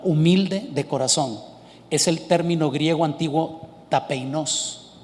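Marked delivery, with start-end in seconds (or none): 3.9-4.9 "tapeinos" with the stress on the last syllable; stress on final O. Shown as a demonstration. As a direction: pronounce Greek "tapeinos", accented on O. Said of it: "O con acento S".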